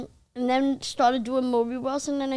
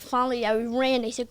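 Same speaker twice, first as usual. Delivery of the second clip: ordinary delivery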